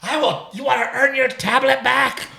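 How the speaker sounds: high, mock-stern parent voice; a hammed-up mother bargaining with a child; imitating wife's stern voice. Father mimics his wife scolding their daughter, high and mock-stern. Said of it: "I heard you today".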